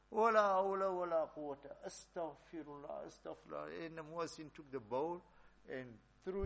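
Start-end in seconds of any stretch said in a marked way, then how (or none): 0.1-3.8 horrified outburst. Acted. Muazzin horrified by dog's kheer.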